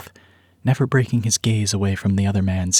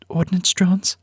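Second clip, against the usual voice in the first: higher, frightened woman's voice; higher anxious female character voice; aunt's alarmed question; 10 semitones higher, slower than usual. Acted. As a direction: ask it as a scared pregnant aunt. Pregnant aunt asks, frightened.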